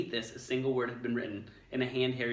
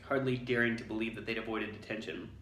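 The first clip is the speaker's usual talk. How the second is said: ordinary delivery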